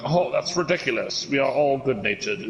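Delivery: mock British dismissal; lightly put-on British accent, dismissive; British scoffing. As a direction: imitate a scoffing British gentleman. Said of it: "all the other British dudes are like".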